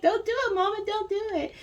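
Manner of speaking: tiny voice pleading with mama; little one's voice. Retold or imitated. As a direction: beg mama like a small child. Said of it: "that's what your little one's energy is like".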